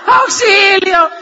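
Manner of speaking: high, loud mock yell of ¡Auxilio!; mock cry for help. Guide retelling scared riders, mock yelling for help. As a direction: ham up a frightened tourist calling for help. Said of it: "comienzan a gritar".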